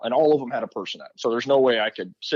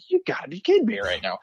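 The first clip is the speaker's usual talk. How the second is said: exasperated; 9 semitones higher than usual. Retold or imitated. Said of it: "I'm just like".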